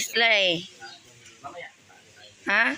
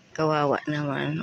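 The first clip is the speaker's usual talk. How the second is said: normal talking voice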